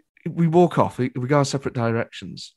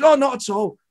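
higher, lightly imitated famous comedian; Billy Connolly; 9 semitones higher than usual. Famous comedian waving away a fan's apology, lightly imitated. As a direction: brush off the apology with a hint of his voice. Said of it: "He goes".

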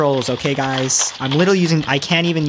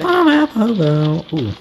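usual speaking voice

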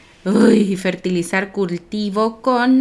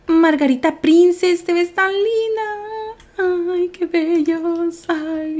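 adoring coo; 8 semitones higher, slower than usual. Real, not acted. Player complimenting a dressed-up pet, high adoring coo. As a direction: coo slowly over adorable pets. Gushing over dressed animals.

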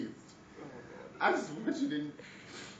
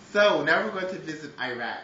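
loud announcement; 9 dB louder than usual. Real, not acted. Announcing next guidebook chapter.

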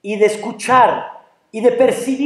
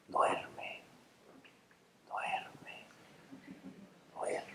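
fading, spaced-out whisper; hypnotic whisper; 7 semitones higher, 26 dB quieter, much slower than usual. Acted. Angel putting the man to sleep for good, whispering. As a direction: whisper it as a lulling command, ever fainter.

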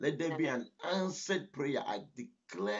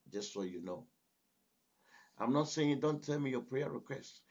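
ordinary talk

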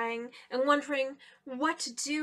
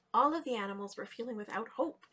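ordinary delivery

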